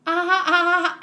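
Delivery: a hammed-up fake laugh; forced laugh. Shown as a demonstration. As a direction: push out a laugh with nothing funny. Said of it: "How can I really laugh".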